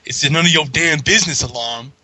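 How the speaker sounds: put-on Cyborg accent, sassy retort; Cyborg-accent comeback, lightly put on; imitated Cyborg accent. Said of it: "who else could have said that with that accent".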